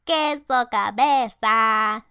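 mild storybook shout, stretched slow. A narrator's reading. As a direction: call it out from the back. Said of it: "shouted the Chimichangos".

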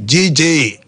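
ordinary talk

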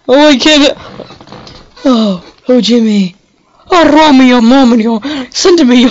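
high, loud hammed-up swoon; over-the-top theatrical love plea; mock swooning lover. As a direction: play a lovesick maiden calling her Romeo. Voicing romantic game character.

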